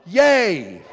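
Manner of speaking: loud happy cheer; a big celebratory yay. Real, not acted. Pastor cheering after the congregation song.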